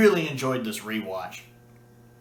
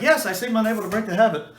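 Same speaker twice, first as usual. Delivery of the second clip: light, breezy and higher, tossed off quickly; flippant; 7 semitones higher, 4 dB louder, faster than usual. Retold or imitated. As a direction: toss it off like it's nothing. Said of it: "he just says it so flippantly".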